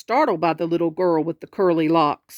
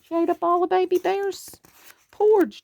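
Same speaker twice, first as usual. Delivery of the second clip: squeaky high aside; childlike high tease; high little voice; 11 semitones higher than usual. Acted. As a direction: lift into a tiny cub voice.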